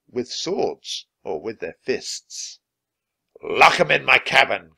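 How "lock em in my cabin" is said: loud pirate command; barked order. Acted. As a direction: order it loudly. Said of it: "Captain Bones ordered".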